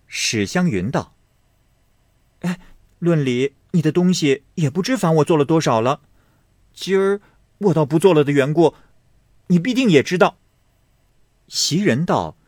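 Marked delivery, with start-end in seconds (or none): 2.4-10.4 high, fast reproach; lightly voiced girl airing a grudge; pointed young lady. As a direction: let the grievance show. Xiangyun complains pointedly.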